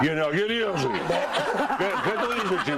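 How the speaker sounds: high, laughing copy of a friend's voice; slightly wrong copied voice. Retold or imitated. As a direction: mimic his way of talking, half laughing. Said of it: "Y tú imitando mi voz".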